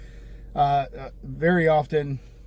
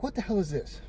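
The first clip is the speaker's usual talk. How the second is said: higher, softer incredulous question; puzzled at an unexpected find; retold bafflement; 6 semitones higher, 4 dB quieter than usual. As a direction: replay a mild double take. Retold reaction to a surprise charge.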